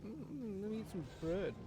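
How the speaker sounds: high-pitched mocking caricature; mock bread eater. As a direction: mimic a bread lover in a silly high voice. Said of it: "Fucking bread eaters".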